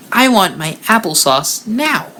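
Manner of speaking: put-on high, demanding little baby voice; a bratty toddler wanting her snack.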